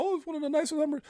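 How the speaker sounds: high, airy mock admirer voice; admiring onlookers. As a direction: gush like an impressed bystander, voice up high. Mimicking people admiring Hummer.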